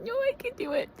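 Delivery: very high mock protest; squeaky refusal. Embarrassed girlfriend refusing to be carried, very high squeak.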